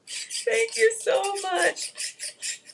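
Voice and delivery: high, very slow, near tears; tearful thanks. Real, not acted. Host thanking viewers while close to tears. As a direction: stretch it out high, barely holding it together.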